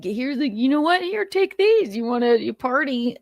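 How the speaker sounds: high, hammed-up mom offering pills; mock mother. Imagined mother offering pills to her son, hammed-up. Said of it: "I can't imagine that a mother would".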